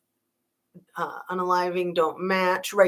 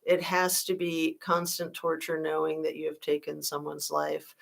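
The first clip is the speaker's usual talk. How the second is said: ordinary talk, nothing marked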